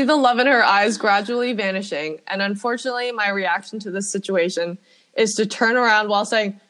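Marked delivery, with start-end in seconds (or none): none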